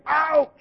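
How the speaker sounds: a high, yelped "Ouch", played for the kids; mock ouch. Preacher to children, mimicking the Israelites' pained "Ouch" in a high yelp. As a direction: yelp "Ouch" like you stubbed a toe. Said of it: "Are they saying".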